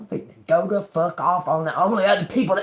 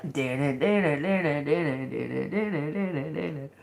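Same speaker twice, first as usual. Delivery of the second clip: chugging train sound, softer and softer; train chug fading; 3 semitones lower, 5 dB quieter than usual. Pretend. Riffing on the crazy train, a chug fading out. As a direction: let the chug die away into the distance.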